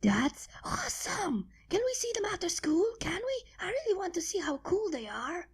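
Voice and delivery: breathless, high, fast and eager; excited shout. A narrator's reading. Scootaloo begging to meet the uncles, excited whispery pleading. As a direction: bounce with excitement as you plead. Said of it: "Scootaloo shouted while bouncing around".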